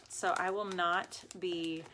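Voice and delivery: usual speaking voice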